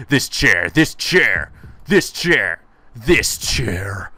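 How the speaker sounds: loud, mounting fury at the chair; obsessive rage. Acted. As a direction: spit it out harder each time.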